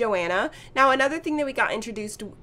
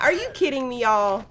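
ordinary talk

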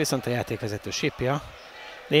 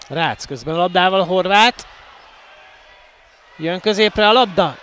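excited commentary; 5 semitones higher, 8 dB louder than usual. Real, not acted. Attack builds toward goal.

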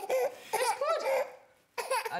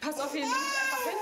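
raised voice calling over; yelling across the room; 9 semitones higher than usual. Real, not acted. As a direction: call out across the room, higher. Calling across room, shout heard.

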